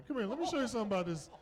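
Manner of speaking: high, quick mock parent summons; eager calling over. Neighborhood parent calling the nurse over, eager and quick.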